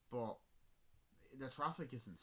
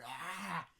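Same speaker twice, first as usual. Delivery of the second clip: mock yell; 8 semitones higher, 5 dB louder than usual. Thugs chasing the car.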